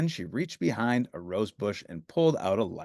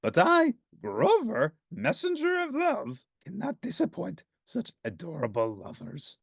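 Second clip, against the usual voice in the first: Grover voice; 8 semitones higher than usual. Acted. Grover declaring grandly.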